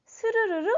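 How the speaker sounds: high imitated sliding noise; a high "seu-reu-reu" for a door gliding open; door-sliding sound. (문 열리는 소리를 높게 흉내)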